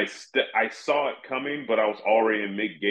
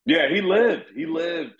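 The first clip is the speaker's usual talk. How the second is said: higher, louder insistence; telling his friend the man survived, higher emphatic answer; 7 semitones higher, 4 dB louder than usual. Real, not acted. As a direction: sell the punchline.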